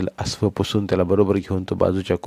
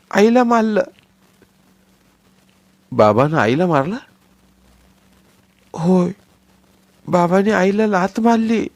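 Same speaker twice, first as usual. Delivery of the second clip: put-on sobbing small child; 11 semitones higher, 5 dB louder than usual. Child voice crying.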